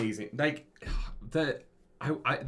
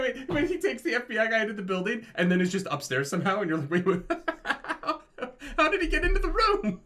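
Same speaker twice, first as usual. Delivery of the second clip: high-pitched, louder, breaking into laughter; laughing disbelief; 9 semitones higher, 3 dB louder than usual. Real, not acted. Podcast host baffled by a movie plot hole, laughing disbelief. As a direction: crack up while asking an incredulous question.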